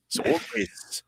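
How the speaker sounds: hushed whispered aside. Real, not acted. Telling the guys about old fight moves, whispered. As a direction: whisper it like a secret.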